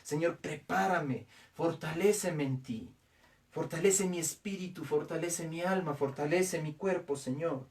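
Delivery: soft, unhurried prayer to the Lord. Acted. Pastor asking God for faith, soft prayer. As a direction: pray quietly and earnestly. Pastor prays before closing.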